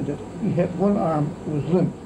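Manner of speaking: ordinary delivery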